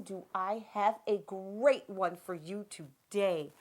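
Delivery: ordinary delivery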